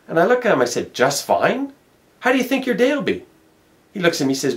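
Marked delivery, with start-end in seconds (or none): 0.9-3.3 incredulous. Retold or imitated. Incredulous challenge to stranger.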